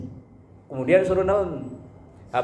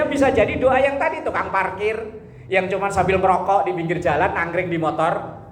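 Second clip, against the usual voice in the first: ordinary delivery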